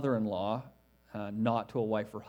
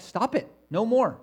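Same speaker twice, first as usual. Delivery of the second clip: higher and louder, insistent refusal; emphatic; 7 semitones higher, 5 dB louder than usual. Retold or imitated. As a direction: put your foot down, no arguing. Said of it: "It's very emphatic".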